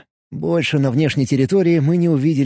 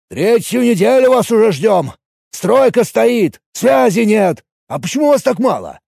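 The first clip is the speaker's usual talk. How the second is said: agitated shouting; 9 semitones higher, 5 dB louder than usual. Acted. Foreman complains about long wait.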